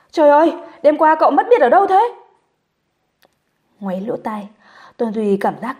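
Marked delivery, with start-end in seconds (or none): 0.1-2.1 high, loud, rushed outburst; exasperated friend shouting down the line; yelling on the phone. A narrator's reading. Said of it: "đối phương đã thét lên". (thét lên gấp gáp)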